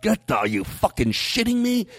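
high, exasperated mock rant; inner angry outburst. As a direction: vent it, high-pitched, as a silent thought. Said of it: "inside, I'm like".

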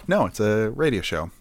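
normal talking voice

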